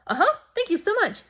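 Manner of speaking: high, quick feigned thanks; sugary polite brush-off; fake polite thanks. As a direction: chirp it, not understanding a word. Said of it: "I'm like".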